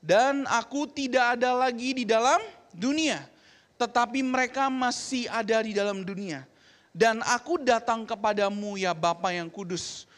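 slow, loud congregational reading; solemn unison Bible reading; scripture reading aloud. Acted. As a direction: read the verse together in unison. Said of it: "Kita baca sama-sama".

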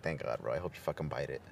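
low, soft muttered relief. Retold or imitated. Recalling his reaction to the girl's death, low mutter. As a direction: mutter it under your breath. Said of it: "I was like".

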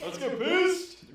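very high, loud and slow party yell; drawn-out cheer. Real, not acted. Player echoing the cheer, stretched out very high and loud.

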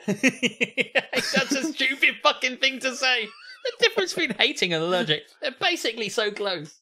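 can't stop laughing. Real, not acted. Co-host cracking up at the post, laughing through words.

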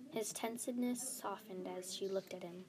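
usual speaking voice

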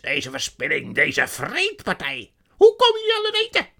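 loud, high-pitched furious demands; enraged sorceress. A narrator's reading. Witch threatens the animals with the whip, furious. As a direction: scream threats at full force.

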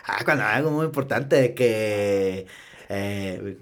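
northern Mexican accent, lightly put on. Shown as a demonstration. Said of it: "bien norteño, ¿no, güey?".

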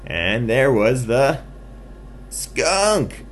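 high, loud, slow build to skunk; big reveal. A narrator's reading. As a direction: announce the find with a pause before the last word. Storyteller reveals the hider.

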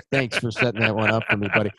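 ordinary talk, nothing marked

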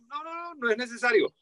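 high, hurried waving-off; retold protest. Bachelor telling his date not to bother, high protest.

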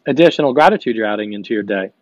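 normal talking voice

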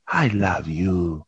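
low slow tender. Retold or imitated. Tender vs ecstatic.

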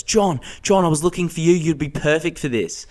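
high, excited calling out his name; eager call. Retold or imitated. Liam's girlfriend spotting him in line, excited high call. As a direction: grab his attention with a bright high call. Siobhan calls him over.

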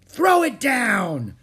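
high, loud, drawn-out bellow; crying out. A narrator's reading. Captain Bones to Pinky with the medallion, loud cry. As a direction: bellow a greedy command slowly. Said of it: "cried Captain Bones".

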